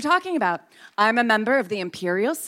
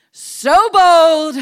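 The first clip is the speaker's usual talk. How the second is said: bold said much louder and higher; loud emphatic repeat; 5 semitones higher, 7 dB louder than usual. Acted. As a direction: belt out the word bold.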